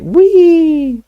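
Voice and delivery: high loud gleeful whoop; gleeful poltergeist. Acted. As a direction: whoop like a mischievous ghost. Peeves cries gleefully.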